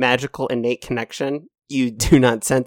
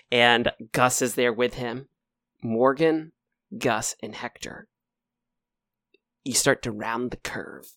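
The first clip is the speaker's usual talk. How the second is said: ordinary talk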